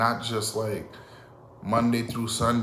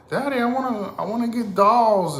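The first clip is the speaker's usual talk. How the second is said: high, pleading little daughter voice; little girl voice; 11 semitones higher, 4 dB louder than usual. Pretend. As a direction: squeak it like a small girl begging for dolls. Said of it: "I can see myself now, like".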